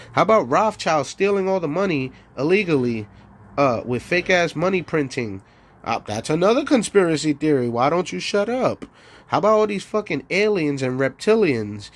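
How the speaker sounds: ordinary talk, nothing marked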